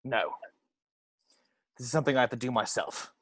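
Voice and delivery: low, soft, grim determination; grim resolve. Acted. Angel refusing the clerk's help, low and grim. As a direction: say it low, steely and quiet. Grim vow at service station.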